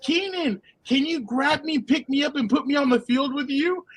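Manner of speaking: put-on high-pitched eager child; kid's voice. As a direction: imitate a kid pleading with a player. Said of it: "Some kid goes".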